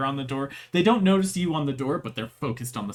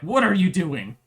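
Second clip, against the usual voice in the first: angry CEO; 5 semitones higher, 6 dB louder than usual. Acted. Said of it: "big, angry C E O man".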